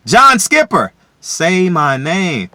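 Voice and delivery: high loud name then slow demand; loud call-out. Real, not acted. Calling out John Skipper, a loud slow shout.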